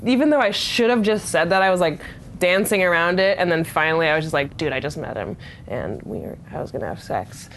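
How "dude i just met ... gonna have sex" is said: low, soft mock admission; resigned confession. She finally confesses to border guards, low and soft. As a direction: drop low and own up quietly.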